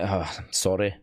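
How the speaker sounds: put-on a quick sheepish sorry in a Scottish accent. The bride apologising to the crew, in a Scottish accent. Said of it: "'cause she's Scottish".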